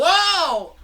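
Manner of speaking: high, loud surprise; startled whoa. Acted. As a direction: jolt awake at the sight. Tired guy sees suit.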